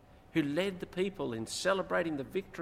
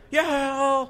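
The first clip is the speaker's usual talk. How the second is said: long, high-pitched yell mimicking a small boy; imitated child yelling; 11 semitones higher, 10 dB louder than usual. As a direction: yell it like a kid in the back seat.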